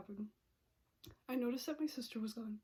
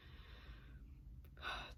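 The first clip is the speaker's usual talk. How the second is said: a soft, long exhale; long sigh; 13 dB quieter than usual. Real, not acted. He finally asked her out, a long soft sigh. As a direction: let out a long, relieved breath.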